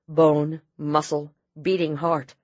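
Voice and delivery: plain reading voice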